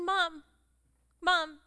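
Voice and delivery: loud, high, insistent calls; little kid calling. Retold or imitated. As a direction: call out for mother like a child. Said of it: "Like a little kid".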